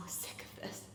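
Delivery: exhausted breathy whisper, barely voiced; a tired whisper of frustration. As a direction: let it slip out under your breath, worn out. Frustrated daughter venting quietly.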